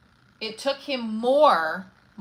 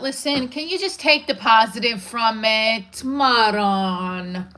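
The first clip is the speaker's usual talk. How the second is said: slow, exasperated put-down, a bit louder; mock snapping retort; 4 dB louder, slower than usual. Answering a rude commenter, mock exasperated.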